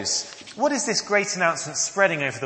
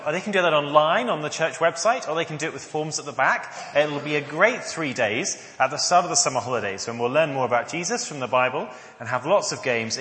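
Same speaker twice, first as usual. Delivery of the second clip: usual speaking voice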